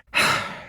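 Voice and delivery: short breathy sigh; worried sigh. Acted. Rescuer with places still to search, worried sigh. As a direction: sigh before getting back to business.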